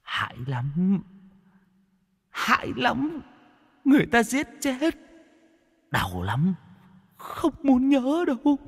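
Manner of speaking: very high, soft, trembling ghost murmur; mumbling frightened ghost. A narrator's reading. Terrified ghost mumbles about its murder to the exorcist. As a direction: murmur in dread of the memory. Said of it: "mồm lẩm bẩm".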